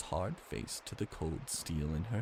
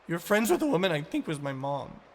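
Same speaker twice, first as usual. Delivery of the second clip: accusing; 10 semitones higher, 5 dB louder than usual. Acted. Ani confronts Ivan.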